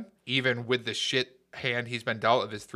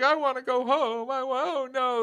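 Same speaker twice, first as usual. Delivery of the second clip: very high, whiny imitation of crying; hammed-up high wail; mock crying; 13 semitones higher than usual. Soldier crying to go home, mock crying. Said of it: "And he's crying".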